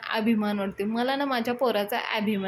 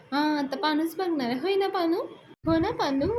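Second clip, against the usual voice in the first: much higher, sing-song coaxing; teasing coaxing; 7 semitones higher than usual. Retold or imitated. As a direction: tease him sweetly into agreeing. Payal coaxing Palya teasingly.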